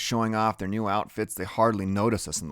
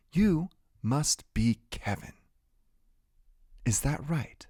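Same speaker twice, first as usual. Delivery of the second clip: hushed teacher; 4 semitones higher, 4 dB quieter than usual. A narrator's reading. Teacher speaks warily to Freak.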